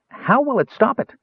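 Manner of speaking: high, quick eager kender voice; a bright, high-pitched young kender eagerly interrupting; eager kender interrupts the mage, high and bright. A narrator's reading. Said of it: "Tass interrupted eagerly".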